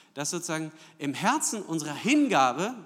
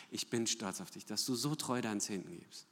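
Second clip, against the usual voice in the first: "Ich bin stolz auf dich" much softer and lower, almost murmured; hushed imagined praise; 7 semitones lower, 9 dB quieter than usual. Pretend. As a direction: let the praise drop to a soft hush. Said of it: "Ich will, dass er sagt". ("Ich bin stolz auf dich" leise und tiefer gesprochen)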